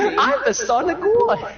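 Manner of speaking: high, slow, laughing cartoon voice; squeaky Jesus. Jesus announcing himself, high and laughing.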